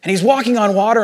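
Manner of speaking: usual speaking voice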